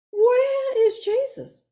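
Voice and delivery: high, slow calling; longing sisters calling for Jesus. Retold or imitated.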